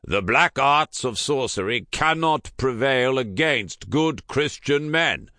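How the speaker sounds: loud, high rallying shout. A narrator's reading. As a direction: rouse the frightened men. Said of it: "he shouted".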